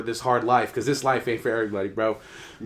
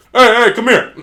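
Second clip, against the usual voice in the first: loud, raised summoning call; coach calling out; 10 semitones higher, 11 dB louder than usual. Retold or imitated. Coach calling the kid over, commanding. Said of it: "And then the coach goes".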